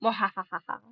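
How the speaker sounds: half-hearted mock evil cackle; evil laugh. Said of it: "I will evil laugh at you back".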